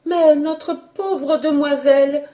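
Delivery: high, tearful-edged maid; lightly voiced grieving maid; young maid voice. A narrator's reading. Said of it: "scanda Juliette".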